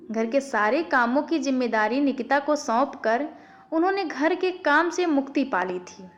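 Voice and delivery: plain reading voice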